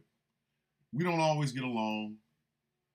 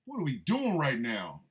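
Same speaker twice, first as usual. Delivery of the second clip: usual speaking voice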